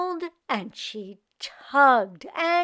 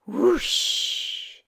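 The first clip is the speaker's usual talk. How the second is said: whoosh; 4 semitones higher than usual. A narrator's reading. Sound effect of pumpkin flying.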